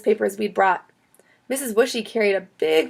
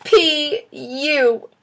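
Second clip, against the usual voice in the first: drawn-out, high-pitched disgust; holding the nose; 7 semitones higher, 3 dB louder than usual. A narrator's reading. Chloe reacts to stinky garbage, holding her nose. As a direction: stretch it out as if pinching the nose. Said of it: "Chloe held her nose".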